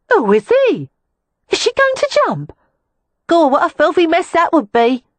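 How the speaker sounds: very high, loud gossiping women; incredulous prostitutes. A narrator's reading. Neighbours at the windows, a high, loud incredulous gawk. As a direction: shriek it with gleeful disbelief. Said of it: "incredulous exclamations".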